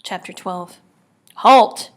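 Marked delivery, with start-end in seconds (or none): 1.4-1.9 barked loud halt order; guard shouting an order to halt; commanding shout. A narrator's reading. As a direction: bark it like a guard stopping them. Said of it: "a voice commanded".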